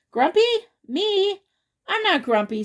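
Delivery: high, loud Grumpy? Me? then protest; indignant surprise. Acted. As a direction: act shocked, squeaky. Jim denies to Lemur.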